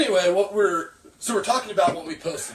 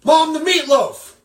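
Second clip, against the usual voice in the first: yelling; 13 semitones higher, 13 dB louder, slower than usual. Pretend. Mock yelling at mom about meatloaf.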